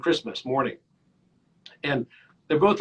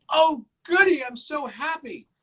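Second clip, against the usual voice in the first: high-pitched imitation of a delighted child; gleeful little boy; 10 semitones higher than usual. Little boy delighted by a room of manure, mock gleeful.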